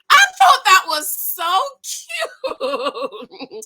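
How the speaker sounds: slow, loud words through laughter; laughing. Real, not acted. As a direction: laugh it out, charmed. Laughing at the dog pun.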